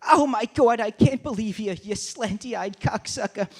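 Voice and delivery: shrill mock yell at another driver; hammed-up angry mom; mom's road rage. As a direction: yell out the car window.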